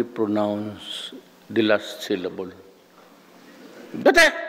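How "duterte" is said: shrill high yell of a name; high shrill mock shout; shrill professor call. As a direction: squeal the surname like the old professor. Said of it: "boses, ano, siya, shrill".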